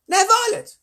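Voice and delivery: very high, loud call to a daughter; mother's loud warning, pitched extremely high; scolding mother. Acted. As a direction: call out piercingly high to your child. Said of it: "Oh, that's her mother".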